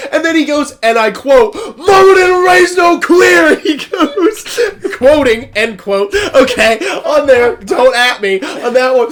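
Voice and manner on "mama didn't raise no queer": high, drawn-out full shout; slow, roaring mock proclamation; screamed declaration. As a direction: imitate a big guy screaming it to the room. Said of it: "Fucking screams at".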